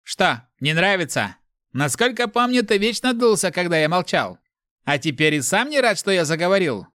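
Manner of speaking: high-pitched needling questions; mocking inner voice, pushed forward; taunting. A narrator's reading. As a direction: goad him, pitched well up.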